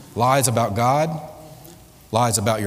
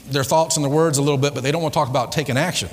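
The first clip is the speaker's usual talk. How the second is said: usual speaking voice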